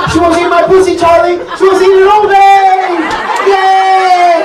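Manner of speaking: high, loud, squealing delight; giddy excitement. Acted. Woman gushing to Charlie, high and giddy. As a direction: squeal it like she can't believe her luck.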